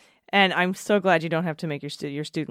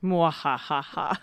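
slow villain cackle; evil laugh; slower than usual. Pretend. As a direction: mwahaha like a cartoon villain. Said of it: "insert an evil laugh sound".

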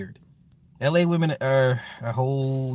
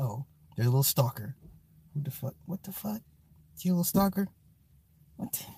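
hushed; 8 dB quieter, faster than usual. Real, not acted. Noticing kid sneaking on stream.

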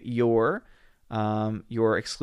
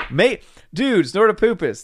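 usual speaking voice

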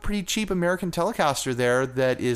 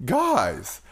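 moon voice; 7 semitones higher than usual. Acted. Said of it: "I guess I could do the moon voice".

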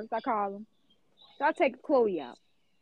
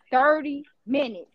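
normal talking voice

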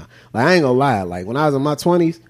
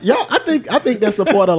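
laughing; 7 semitones higher than usual. Real, not acted. Laughing at free stuff.

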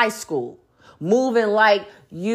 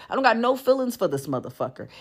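ordinary talk

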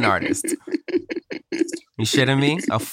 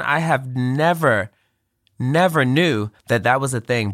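formal tribute speech; 4 semitones higher than usual. Acted. Host's tribute speech to close the show.

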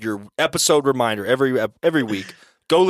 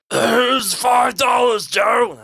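high, drawn-out hammed-up shout; shouting in character; 11 semitones higher, 6 dB louder, much slower than usual. A narrator's reading. Eddie hands over the money to Joe, high slow shout. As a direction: call it loudly down a dark tunnel. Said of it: "Eddie shouted".

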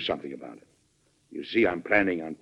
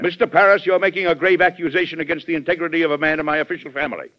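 stern rebuke; 7 semitones higher, 4 dB louder, faster than usual. Acted. President rebukes a grave accusation.

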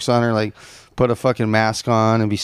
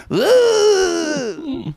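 squeaky pained groan; high strained groan. Retold or imitated. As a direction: groan like a confused patient. Mocking brain-damaged boyfriend.

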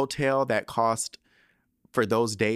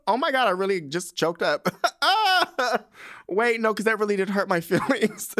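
higher, louder, laughing as she chokes up; laughing through tears; 10 semitones higher, 4 dB louder than usual. Real, not acted. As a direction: laugh it off while still a little teary. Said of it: "how I go from crying to laughing".

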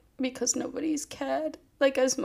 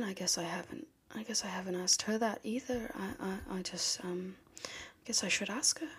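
whisper; 2 semitones lower, 8 dB quieter than usual. Acted. Admits neglecting his wife.

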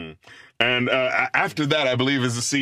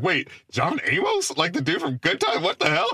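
stunned disbelief; 7 semitones higher than usual. Retold or imitated. Retelling own disbelief.

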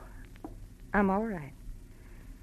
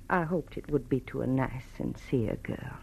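softer, lower, trailing sigh; wistful; 6 semitones lower, 4 dB quieter than usual. Acted. Mother confiding her hopes for her son, wistful and soft. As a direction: let the hope fade out.

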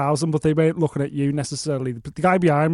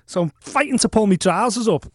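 usual speaking voice

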